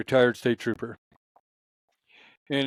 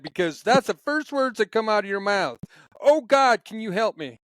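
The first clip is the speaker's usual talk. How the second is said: high-pitched imitated Southern trooper; Southern accent; 7 semitones higher than usual. Trooper explaining the first words after a wreck, imitated Southern accent. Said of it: "do my Southern accent that he does".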